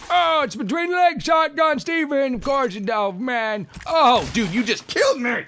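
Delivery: high, loud disbelieving shout; excited shout. Real, not acted. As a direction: yell at the absurd shotgun. Reacting to crotch shotgun.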